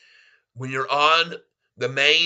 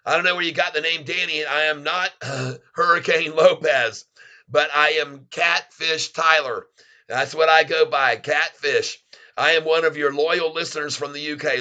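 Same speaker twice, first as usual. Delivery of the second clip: ordinary talk, nothing marked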